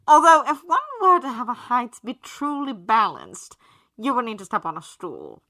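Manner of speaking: loud, pompous, theatrical aristocrat; haughty gentleman voice. A narrator's reading. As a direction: sneer it grandly like a snob.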